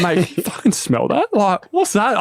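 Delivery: high, rising disbelief; disgusted surprise. Retold or imitated. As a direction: react to a horrible smell. Reacting to a fart smell.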